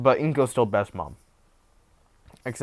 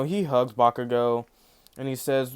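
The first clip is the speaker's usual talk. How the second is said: ordinary talk, nothing marked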